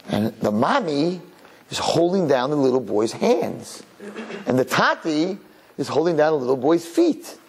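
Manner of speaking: usual speaking voice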